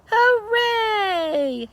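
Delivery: high cheering cry; joyful yell. A narrator's reading. Amelia Bedelia cheering the pitched tent, high joyful yell. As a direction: shout it like you just won. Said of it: "yelled Amelia Bedelia".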